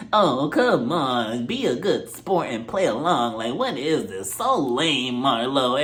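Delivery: drawn-out, whining chide; mock exasperation. Kandi chiding Marlo for not playing along, mock exasperation. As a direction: coax a reluctant friend, dragging the words.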